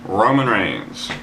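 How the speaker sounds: slow, drawn-out announcer call; slow mock ring-announcer call of the matchup. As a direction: stretch each name like a ring announcer. Announcing the big match card.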